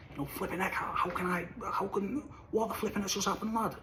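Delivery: hushed disbelief. Real, not acted. Heartbroken lad asking himself what just happened, hushed and baffled. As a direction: drop the volume, stunned. Bewildered about his heartbreak.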